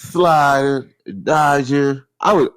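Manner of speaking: slow, high, laughing boast; laughing brag. Real, not acted. Player boasting about dodgeball moves, slow laughing brag. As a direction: savor the brag through a laugh.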